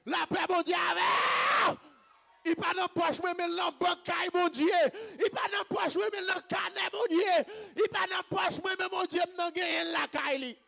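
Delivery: high, loud, driving refrain; full-force preacher's cry; impassioned shouted preaching. Real, not acted. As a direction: shout each line to the congregation.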